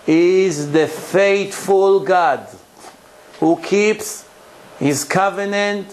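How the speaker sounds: slow, measured verse with pauses between phrases; solemn, drawn-out recitation; scripture reading. Acted. Reads scripture verse aloud, translated.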